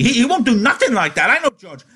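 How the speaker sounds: very high-pitched desperate insistence; high, frantic protest. A narrator's reading. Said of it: "Lenny cried frantically".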